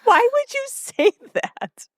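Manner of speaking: high, slow, disbelieving squeal; aghast high-pitched question; incredulous. Real, not acted. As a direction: stretch it out in shocked disbelief. Reacting to creepy quote.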